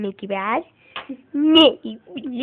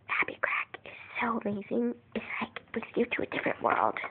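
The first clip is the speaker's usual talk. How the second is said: soft whispered praise; 3 semitones lower, 16 dB quieter than usual. Real, not acted. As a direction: whisper it dreamily. Whispering dreamily.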